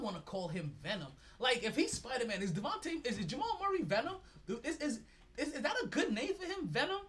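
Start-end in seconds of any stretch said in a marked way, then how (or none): 1.4-7.0 rising, thrilled disbelief; hyped up, pitch climbing high; excited. Real, not acted. Hyped comparing players to Spider-Man.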